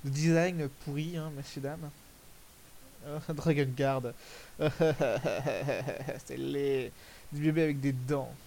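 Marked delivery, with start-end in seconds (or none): 4.2-6.2 long rippling laugh; helpless run of chuckles. Real, not acted. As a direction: burst into a drawn-out, stuttering laugh. Laughing at an ugly character design.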